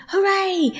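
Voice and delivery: sudden high-pitched cheer; jubilant cry. A narrator's reading. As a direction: burst out cheering at a discovery. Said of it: "Suddenly the rat cried".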